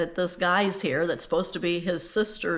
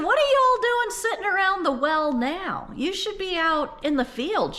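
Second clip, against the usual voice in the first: bossy know-it-all; 12 semitones higher than usual. Acted. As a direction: lecture them like a know-it-all. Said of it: "got all bossy and know-it-all-y".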